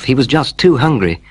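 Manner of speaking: ordinary delivery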